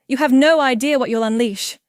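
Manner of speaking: loud, desperate warning; pleading. A narrator's reading. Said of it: "she pleads, mask finally cracking".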